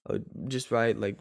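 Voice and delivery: ordinary delivery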